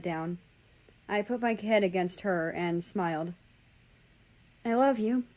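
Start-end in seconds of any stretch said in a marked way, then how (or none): 4.7-5.2 raised, tender I love you with rising end. Acted. Son to dying mother.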